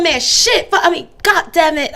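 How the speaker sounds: loud, high, half-laughing cussing; mock angry cursing. Worker mad at a customer, loud mock cursing. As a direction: let the swears fly, then catch yourself. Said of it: "When I get mad at the customer".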